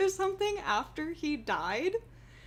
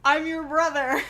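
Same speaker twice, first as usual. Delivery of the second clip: mock dramatic reveal; 3 semitones higher, 8 dB louder than usual. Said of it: "he was like".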